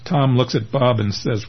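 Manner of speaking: plain reading voice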